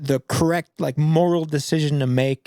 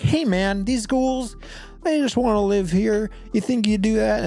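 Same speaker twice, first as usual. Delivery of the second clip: chummy persuading; 7 semitones higher than usual. Retold or imitated. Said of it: "be like".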